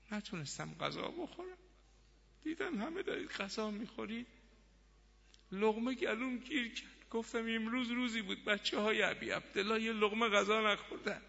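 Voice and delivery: soft, high, strained lament; tearful grief. Acted. Wife mourning Hussein's hungry children, tearfully. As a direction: voice climbs as if choking back tears. Wife recalls choking on food, grieving.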